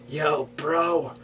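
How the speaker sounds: laid-back surfer bro accent; Californian accent. Shown as a demonstration. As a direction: do a surfer dude voice. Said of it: "I could do a Californian one too".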